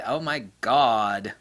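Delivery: put-on slow drawn-out Valley girl Oh my God. Vlogger slipping into a slow Valley girl Oh my God. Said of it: "I'm talking like a Valley girl".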